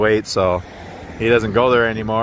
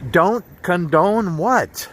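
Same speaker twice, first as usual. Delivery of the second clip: higher, slow mock-angry wife, words stretched out; upset wife; 7 semitones higher, much slower than usual. Wife repeating herself to her confused husband, mock angry. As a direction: repeat it like a wife losing patience. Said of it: "And she says".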